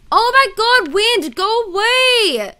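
exasperated loud yell; annoyed yell at the wind. Real, not acted. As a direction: shout it off like it can hear you. Yelling at loud wind noise.

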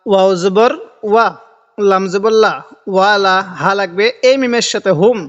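ordinary talk, nothing marked

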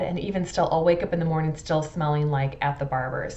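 ordinary talk

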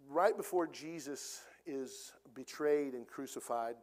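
usual speaking voice

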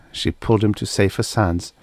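usual speaking voice

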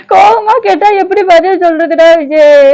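slow, drawn-out mock wail; put-on crying. Wife protesting husband's angry questions, mock wailing. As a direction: whine tearfully in protest.

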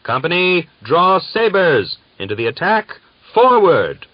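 loud, high cavalry charge order; battle command shout. A narrator's reading. Commander ordering the Cossacks to charge, shouting. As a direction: yell the company into the attack. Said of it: "the further command came".

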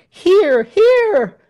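very high, loud cheers; approving shouts. A narrator's reading. Crowd approving Tell's speech, shouting. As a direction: shout agreement at full voice. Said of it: "Shouts of".

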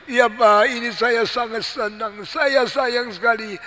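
high, whining mock sobs; crying child. Child explains loved doll to father, high mock-crying voice. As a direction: plead like a tearful child. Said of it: "lalu engkau nangis".

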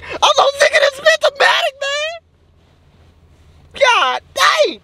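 very high-pitched tearful squeal; squealing meltdown. Acted. Vlogger sick of it all, squealing in frustration. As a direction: cry it out in a tiny, strained squeal.

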